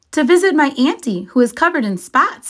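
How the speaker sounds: put-on high, quick little girl voice; Little Red's voice. A narrator's reading.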